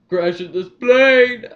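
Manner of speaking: booming, slow villain shout, high and drawn out; Bane. Retold or imitated. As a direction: bellow it very loud, stretching each word. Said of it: "why did he say it like that".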